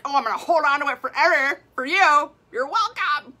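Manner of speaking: mock body voice. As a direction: voice your body as a cheeky helper. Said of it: "your body's like".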